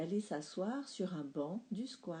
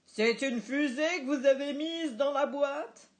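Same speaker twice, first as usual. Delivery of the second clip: loud, higher challenging question; indignant dad; 6 semitones higher, 9 dB louder than usual. A narrator's reading. Dad challenging the waitress, loud and indignant. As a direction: demand an explanation.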